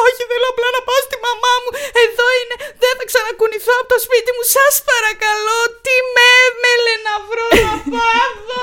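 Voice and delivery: high, fast, weepy wail; melodramatic sobbing. Pretend. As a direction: beg to go home to mum, overacting. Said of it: "πατάω ένα μονόπρακτο πρώτο γυναικείο". (θεατρικό κλάμα, γρήγορο και ψιλό)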